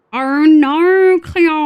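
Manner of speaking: high, loud hammed-up Australian cry; exaggerated Australian accent. Streamer parodying a TV line for chat, exaggerated Australian accent. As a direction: belt it out with overdone Australian vowels. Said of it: "he is the Australian accent".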